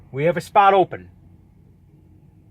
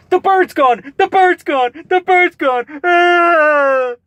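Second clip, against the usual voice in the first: panicked yell; 14 semitones higher, 8 dB louder, slower than usual. Retold or imitated. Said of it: "He's like".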